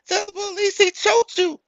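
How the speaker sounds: put-on very high woman's voice coaching the child; mock the mother feeding lies; hammed-up high woman's voice; scheming mother. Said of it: "She coaching the kid".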